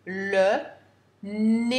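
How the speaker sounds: nénuphar said clearly for dictation; dictation read. Shown as a demonstration. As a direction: read out nénuphar syllable by syllable for pupils. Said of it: "Je répète une dernière fois".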